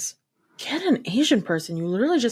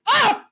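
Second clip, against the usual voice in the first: sudden high squeal, louder; shrieked ah; 5 dB louder than usual. Retold or imitated. Mimicking the villain's outraged shriek at the princess. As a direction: let out a short shocked squeal. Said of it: "And he's like".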